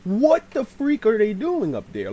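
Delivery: loud, high-pitched disbelief; indignant reenacted cry; exasperated outburst. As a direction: burst out at the noise overhead. Woken again by the banging.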